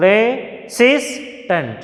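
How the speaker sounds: Resistant drawn out slowly, SIS lifted; slow teacher demo of re-SIS-tant; stress on SIS. Shown as a demonstration. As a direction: stretch Resistant and hit its middle. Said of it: "stressed syllable we have to highlight".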